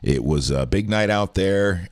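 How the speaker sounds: ordinary talk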